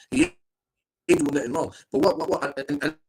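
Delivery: usual speaking voice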